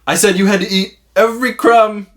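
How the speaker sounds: high, loud hammed-up telling-off; mock scolding. Uncle scolding nephew over a crumb, exaggerated outrage. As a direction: lay down the law theatrically.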